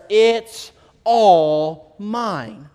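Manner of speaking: very slow, loud and solemn; God's declaration. Acted. God declaring ownership of the world, slow solemn proclamation. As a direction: stretch every word like a decree from heaven. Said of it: "he declares".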